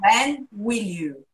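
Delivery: very slow, each word stretched out; slow drilled demo. Shown as a demonstration. Teacher models the question slowly for the student. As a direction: drag it out so the student can copy it. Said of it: "Segura no N".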